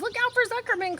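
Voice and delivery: high warning honk; gander calling out. A narrator's reading. Gander warning Wilbur, high and loud. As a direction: shout the warning. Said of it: "called the gander".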